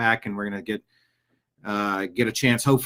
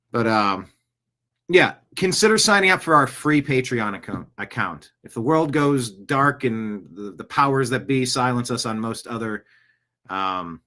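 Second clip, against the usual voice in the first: normal talking voice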